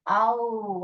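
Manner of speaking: आओ stretched slowly as one gliding sound; drawn-out vowel sound. Shown as a demonstration. Teacher giving children a dictation clue, आओ slowly drawn out. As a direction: draw out आओ so the vowel stands out. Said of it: "first syllable में जो है".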